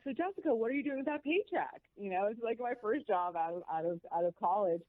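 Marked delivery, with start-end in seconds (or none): 0.0-1.8 mentor voiced much higher, quizzing; bright high-pitched mock of the mentor asking; mentor's question. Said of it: "And he's like".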